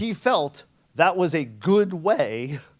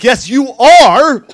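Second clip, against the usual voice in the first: slow, loud and much higher insistence; insistent shout; 7 semitones higher, 16 dB louder, much slower than usual. Retold or imitated. SOC guy insisting to the pen tester, slow loud shout. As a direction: hammer each word home, slowly and loudly. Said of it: "He's like".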